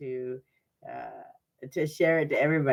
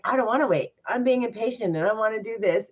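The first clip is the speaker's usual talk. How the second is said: impatient whine; 7 semitones higher, 3 dB louder than usual. Retold or imitated. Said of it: "those are the things where it's like".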